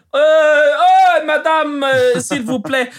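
loud, high French caricature; mock French. Joker playing a Frenchman addressing Madame, mock French. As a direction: ham up French, high and drawn out. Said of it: "wie sprechen sie Franzosen aus".

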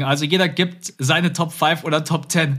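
normal talking voice

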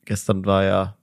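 ordinary talk, nothing marked

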